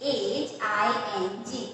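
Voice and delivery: slow, letter by letter dictation; teacher spelling each letter clearly. Shown as a demonstration. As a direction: spell it out slowly for the class. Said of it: "next है".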